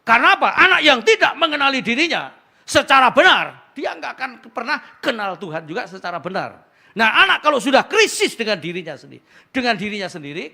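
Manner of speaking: loud, high preaching voice; preaching at full force. Acted. Pastor drives the point home to the congregation, loud and high.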